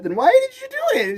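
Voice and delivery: jumps way up in pitch, baffled; high incredulous demand; exasperated squeak. Retold or imitated. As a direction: squeal it in disbelief. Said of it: "I go".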